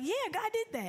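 high, bright mock churchgoer; church lady quote. Church lady retold, high and beaming about the blessing. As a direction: pitch way up like a thrilled church lady. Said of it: "And she was like".

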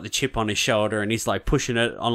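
normal talking voice

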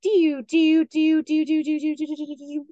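high, loud whistled dee-oo notes, fading at the end; field sparrow song. Shown as a demonstration. Teacher imitating a field sparrow song for birders, high whistled notes. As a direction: whistle it like a bouncing ping pong ball. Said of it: "the sound of the field sparrow goes".

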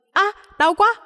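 high-pitched cry of pain; pained yelp. A narrator's reading. Boy drops a bag on his foot, pained yelp.

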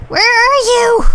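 high, stretched holler; exasperated high-pitched yell; frustrated calling out. Real, not acted. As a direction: call far across the map for someone hiding. Calling out to unseen players.

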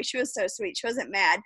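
normal talking voice

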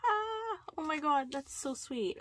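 high cry easing down into fondness; touched delight. Real, not acted. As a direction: start on a high happy gasp, then soften. Touched by sender's gift.

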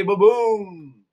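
high, drawn-out thunder sound effect; imitated thunder boom. Shown as a demonstration. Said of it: "it makes a noise".

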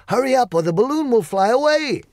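high, loud hurried call; urgent cry. Acted. Wizard calling Dorothy to the balloon, loud and urgent. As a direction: hurry her before it lifts off. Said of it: "cried the wizard".

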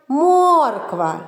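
Морква with a long, high О; виділений звук О. Shown as a demonstration. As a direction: hold the first vowel of Морква up high. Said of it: "в назві яких є звук О". («Морква» з протяжним високим «о»)

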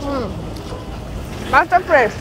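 chewing while talking; speaking between bites; mouth full. Real, not acted. Seen 3: bit fish at -3, lips closed, jaw working while speaking.

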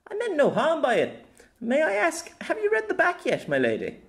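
high-pitched, deferential knight; knight addressing his lady. Acted. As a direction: plead innocence in a high voice, dropping at the end.

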